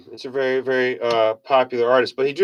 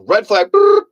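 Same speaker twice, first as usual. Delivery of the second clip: alarm call; 7 semitones higher, 6 dB louder than usual. Retold or imitated. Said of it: "I'm like".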